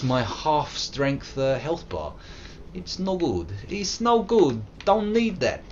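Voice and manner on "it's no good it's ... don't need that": higher broken-English voice; mock foreign accent. Joking to chat about his health bar, high put-on accent.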